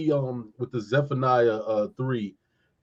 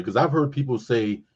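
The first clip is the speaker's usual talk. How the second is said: ordinary talk, nothing marked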